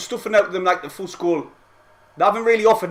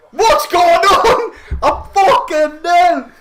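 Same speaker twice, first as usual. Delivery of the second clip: stunned laugh; 7 semitones higher, 5 dB louder, much slower than usual. Real, not acted. Fan stunned, laughing in disbelief.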